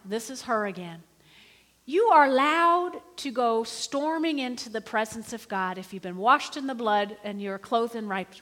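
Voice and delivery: usual speaking voice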